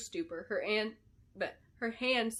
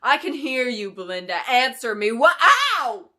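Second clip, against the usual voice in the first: angry shouting; 4 semitones higher, 15 dB louder, slower than usual. A narrator's reading. Clarice shouting demands.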